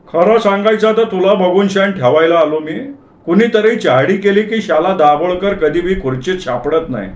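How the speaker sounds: put-on high-pitched elderly Parsi character voice; old Parsi officer. Parsi officer revealing why he came to inspect, high old-man voice. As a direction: confide as an old Parsi visitor. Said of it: "शेवटी ते म्हणाले".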